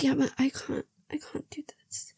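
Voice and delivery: high, soft plea fading into whisper; hammed-up helpless whisper, trailing away; whispery mock anguish. She answers Noah's protest, high fading whisper. Retold heroine refusing again.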